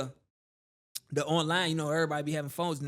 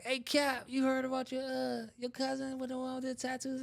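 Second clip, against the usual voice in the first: Girly Bay voiced; 8 semitones higher than usual. Girly Bay breaks the news.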